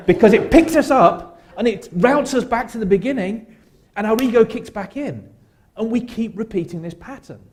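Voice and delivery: high and loud, spinning through the words; whirling tornado excitement. Acted. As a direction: sweep them up like a twister. Playful shame tornado image.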